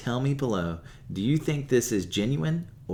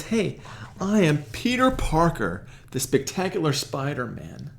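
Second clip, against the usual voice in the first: proud unmasking; 6 semitones higher, 3 dB louder than usual. Retold or imitated. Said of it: "he says".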